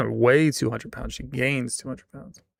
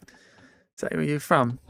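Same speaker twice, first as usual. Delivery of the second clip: normal talking voice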